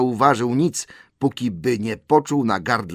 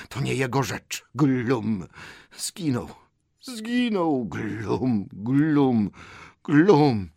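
high creature lament with throaty gulps; croaking Gollum; 4 semitones higher, much slower than usual. A narrator's reading. Gollum grieves his lost ring, croaking and gulping. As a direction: wail and gulp like a creature. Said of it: "zaskrzeczał Golum".

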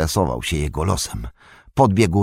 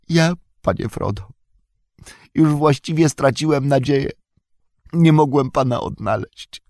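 Sam, tearful and tender; 10 semitones higher than usual. A narrator's reading. Sam comforting Frodo in his arms, tender tearful voice. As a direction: comfort him gently through tears. Sam's voice.

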